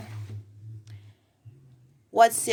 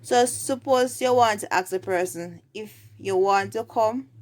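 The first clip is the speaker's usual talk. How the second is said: ordinary talk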